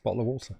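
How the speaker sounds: soft, casual bottle of water, t's caught; glottal stop. Shown as a demonstration. Streamer repeating the simple example, soft glottal stops. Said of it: "It's a glottal stop".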